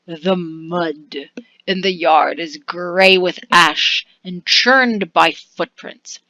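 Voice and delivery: ordinary talk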